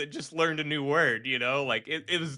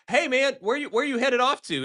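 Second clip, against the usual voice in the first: high-pitched imitation of someone calling down a hallway; casual passer-by; 10 semitones higher, 4 dB louder than usual. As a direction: casually hail a passing guy, voice pitched up. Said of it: "like, somebody, like, saw him walking down the hallway".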